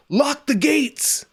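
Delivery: drawn-out high-pitched yell; mock yell. Podcaster quoting a movie's gate guard, mock yell. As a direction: holler it long and high, like a movie line. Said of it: "there's a guy who yells".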